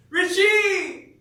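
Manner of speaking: high, loud yell into the night; calling out. Real, not acted. Camper answering the campground's tradition, loud call out. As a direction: holler the name to far-off campers.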